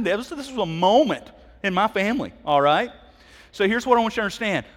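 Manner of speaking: ordinary talk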